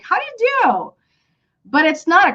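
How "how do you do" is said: "How do you do" high, swooping down on the last "do"; question intonation. Shown as a demonstration. Teacher showing why it sounds like a question, question intonation. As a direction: lift "How do you do" well up, like asking. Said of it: "have an intonation like".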